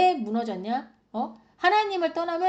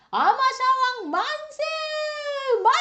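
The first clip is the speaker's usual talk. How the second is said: 환호하는 백성들; 13 semitones higher, 4 dB louder than usual. Acted. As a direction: hail the king like a jubilant crowd. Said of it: "백성들이 환호를 하고". (높고 길게 외치는 백성들의 환호)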